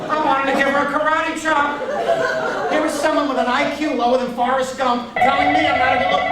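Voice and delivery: high, indignant comic rant; mock outrage. Comic fuming that she rejected his looks, high mock outrage. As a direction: let wounded vanity push the pitch way up.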